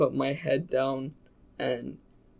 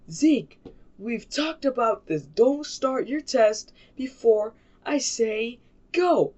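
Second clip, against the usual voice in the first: high-pitched, exasperated scolding; raised-pitch teacher reprimand, stretched out at the end; mock angry teacher; 7 semitones higher than usual. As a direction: imitate a fed-up teacher telling a kid off. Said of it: "pissed, like, so pissed".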